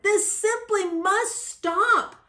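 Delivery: high, slow, emphatic shout; exasperated storybook shout; shouted demand. A narrator's reading. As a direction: call a halt, stretching each word. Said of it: "Then he sat up and shouted".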